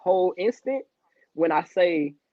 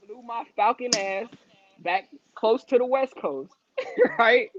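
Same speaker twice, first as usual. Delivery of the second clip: higher, laughing through the words; 5 semitones higher than usual. Real, not acted. Man telling a podcast host his moves, laughing. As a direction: giggle through a proud boast.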